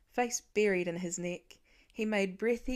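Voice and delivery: ordinary talk, nothing marked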